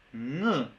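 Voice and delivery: "ng" hummed at the back of the mouth, opening to a short vowel; velar NG sound. Shown as a demonstration. Teacher modelling the Mapudungun sound, velar NG sound. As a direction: sound out "ng" alone as one consonant. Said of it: "se pronuncia como".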